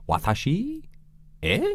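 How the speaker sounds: high cute anime questioning; cutesy anime fan. Pretend. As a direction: squeak it like an anime girl.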